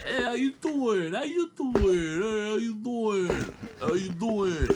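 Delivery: slow, high, loud Staten Island greeting; hammed-up Staten Island "how you doing"; Staten Island accent. Mocking how Staten Island locals greet, Staten Island accent. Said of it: "they fucking talk like that".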